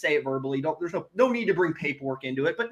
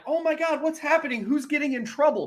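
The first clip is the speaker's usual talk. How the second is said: much higher, wide-eyed faux innocence; mock surprise; 9 semitones higher than usual. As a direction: play dumb like it can't be you. Police lights behind him.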